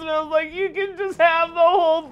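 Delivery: high, loud mock sobbing; crying. Boy whining to his dad after the big bite, hammed-up crying. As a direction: wail it like a kid whose lasagna is gone. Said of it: "crying just like this".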